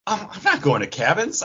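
ordinary talk

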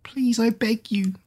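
high, terrified pleading; crying out. Acted. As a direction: beg in panic. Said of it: "the pale girl cried out".

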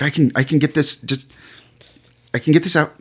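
high, insistent stammer; flustered maid. Acted. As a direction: play a maid anxious to fix it. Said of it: "And she just kind of, like".

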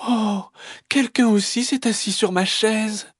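high, sweetly dismayed mother; gentle mama bear. Acted. Mama bear finding her chair used, gentle high voice. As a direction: coo it in surprise as mama bear. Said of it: "dit de sa voix douce".

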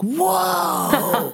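mock amazement. People shocked at patois speaker.

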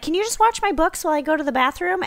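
high, quick, casual request; girl asking favor. Retold or imitated. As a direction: imitate a student asking strangers a small favor. Acting the girl at bar.

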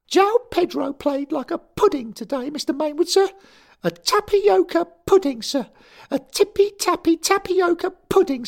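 a furious choirboy insisting he's grown; angry schoolboy. A narrator's reading. Derek protesting to his boss, high indignant boy.

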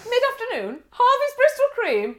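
high, loud, teasingly incredulous; mock judgement. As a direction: raise an eyebrow at her. Mock judging shop assistant.

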